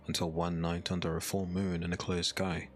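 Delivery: normal talking voice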